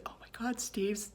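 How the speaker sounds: hushed, high gasp of alarm; acted breathless horror, calling on husband under the voice; retold freak-out, soft and high before the outburst. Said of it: "I freaked out".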